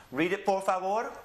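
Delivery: ordinary talk, nothing marked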